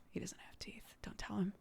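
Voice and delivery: soft secret aside; whisper. Real, not acted. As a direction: whisper it so he won't hear. Joking aside about the cat.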